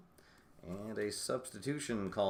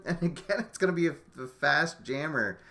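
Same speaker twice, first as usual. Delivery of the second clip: laughing in disbelief; 7 semitones higher, 6 dB louder than usual. Real, not acted. Repeat bad luck, laughing.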